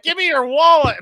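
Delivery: very high, loud pretend demand; hammed-up robber ordering a tourist; mock mugger. Acting a street robber confronting Jesse.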